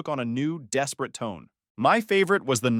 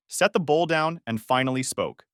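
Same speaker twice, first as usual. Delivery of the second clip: ordinary delivery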